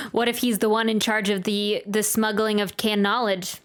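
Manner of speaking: ordinary talk, nothing marked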